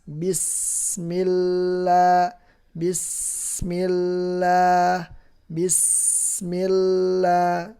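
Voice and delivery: bismillah slow with a light, thin lam. Shown as a demonstration. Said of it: "Jadi dibaca tipis". (lam pada bismillah dibaca tipis)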